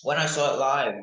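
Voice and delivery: ordinary delivery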